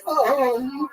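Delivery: high, breathy imitation of a little "Oh"; playful impression of a friend's "Oh"; Lee's "Oh". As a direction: mimic his surprised "Oh", way up high. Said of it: "I love when he goes".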